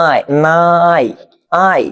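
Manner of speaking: "নাই" stretched very slowly on its long aa glide; sounding out. Shown as a demonstration. Teacher showing the আয় inside নায়ক, sounding out. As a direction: draw out "আয়" to isolate it from নায়ক. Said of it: "তাহলে আয় হলে কি হবে?".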